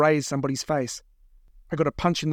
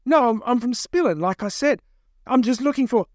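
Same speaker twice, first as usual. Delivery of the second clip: higher-pitched, stammering protest; flustered captive; 9 semitones higher, 4 dB louder than usual. Acted. Captive insisting on his origins, flustered. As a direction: protest in a hurry to be believed.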